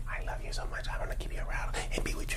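barely audible, voiceless whisper. Acted. Partner's sweet words, acted out for listeners, whispered. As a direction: whisper it so they strain to hear. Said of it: "But the words are saying".

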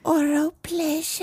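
high, drawn-out put-on voice; mock girlfriend. Girlfriend makes boxer a request, high slow mock voice. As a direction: stretch it out in a high mock accent.

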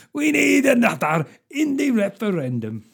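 mock Scottish politician, building to a high rallying cry; Nicola Sturgeon. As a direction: do a Scottish nationalist leader, swelling at the end. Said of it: "as Nicola Sturgeon".

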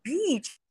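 "beach" with a long, held ee; long-vowelled "beach"; stretched vowel. Shown as a demonstration. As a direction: draw out the ee in "beach". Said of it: "precisa esticar".

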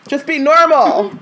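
high, loud exasperated plea; exasperated just be normal. Acted. Host pleads with the wizards, high and exasperated. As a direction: beg them to act normal. Repeat scolding punchline.

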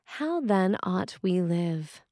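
ordinary talk

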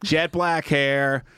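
normal talking voice

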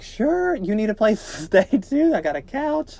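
high-pitched, overeager invitation; hammed-up, squealy yes to a visitor; eager mock welcome. Imagines inviting the boys in.

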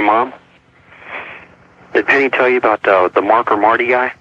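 ordinary delivery, nothing marked

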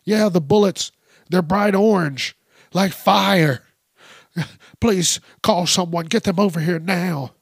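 put-on overexcited old woman begging for help; old caller urging the dispatcher to send someone now; frantic old lady. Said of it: "they called the cops and were like".

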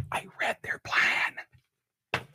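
slow breathy whisper, barely voiced; Joe Biden impersonation. Host mocking the president with a slow whisper. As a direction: mumble it in a slow whisper like an old man. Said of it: "That's my Joe Biden impersonation".

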